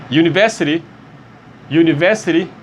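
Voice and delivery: University begun with a Y sound; English pronunciation. Shown as a demonstration. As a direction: open University with a Y glide. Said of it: "é o som também de Y". (University com som de Y no início)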